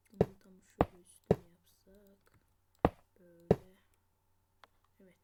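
whispered planning to himself. Real, not acted. Whispering a build idea to himself, hushed. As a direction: breathe it out as a secret plan.

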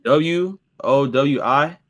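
usual speaking voice

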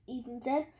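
plain reading voice

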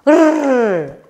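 high, long "rrr" like a tugging puppy; drawn-out growled R sound; playful puppy growl. Pretend. Teacher as puppy pulling the rag, high playful "rrr". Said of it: "puppy pulls the rag".

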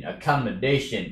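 ordinary delivery

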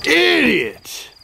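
high, drawn-out insult; scolding cry. Real, not acted. Scolding Rick for his mess.

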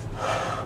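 airy, soft breath out; long exhale. Pretend. Smoker savoring a hit, long exhale. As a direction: let out a long smoky exhale.

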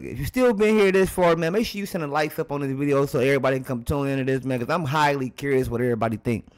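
usual speaking voice

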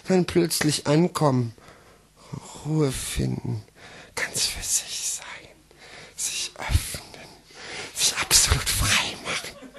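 soft, slow, sinking into a whisper; hushed relief. Acted. Slam poet reaching calm in his text, hushed whisper. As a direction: drop suddenly to a whisper, with long pauses. Poem drops suddenly into calm.

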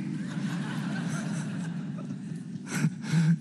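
breathy laugh at the irony; quiet amused chuckle; real laugh. Real, not acted. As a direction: let a soft laugh out.

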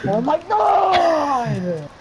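high, long drawn-out shout; exasperated shout. Real, not acted. Player who can't get a kill, exasperated drawn-out shout. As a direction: shout it slowly in real frustration.

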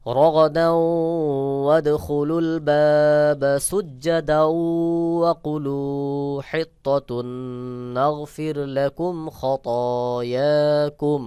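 very slow, high melodic recitation; Quran recitation. Shown as a demonstration. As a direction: chant the verse, stretching each vowel. Recites the verse after spelling. (ধীর সুরেলা কুরআন তেলাওয়াত)

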